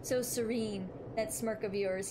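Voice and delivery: ordinary talk, nothing marked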